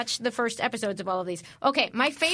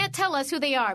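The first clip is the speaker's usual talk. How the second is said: normal talking voice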